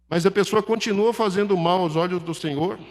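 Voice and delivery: ordinary talk, nothing marked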